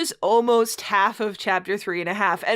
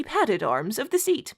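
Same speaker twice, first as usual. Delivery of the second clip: mock-grand read-aloud, stifling laughter; story narration; 5 semitones higher, slower than usual. Host reading a bad fanfic to co-host, mock-solemn narration. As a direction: read the purple prose with a straight face.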